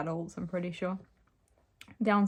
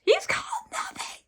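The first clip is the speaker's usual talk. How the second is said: very high, strained squeal of a scream; failed scream; 5 dB louder than usual. Acted. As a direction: attempt a scream with hardly any voice. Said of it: "I can't scream".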